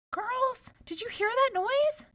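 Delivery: high, anxious call. A narrator's reading. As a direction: call after the sisters who hurry on. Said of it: "called the youngest princess".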